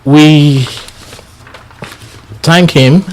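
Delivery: usual speaking voice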